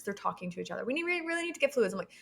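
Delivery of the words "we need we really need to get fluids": faster, higher pressing repeat; more urgent midwife. Retold or imitated. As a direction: rush it, more insistent.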